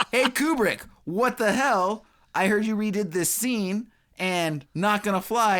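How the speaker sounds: high-pitched mock angry challenge; indignant confrontation. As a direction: act out an irate star confronting his director. Acting out Douglas confronting Kubrick.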